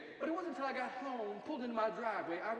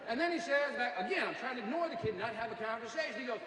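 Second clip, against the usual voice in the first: ordinary talk, nothing marked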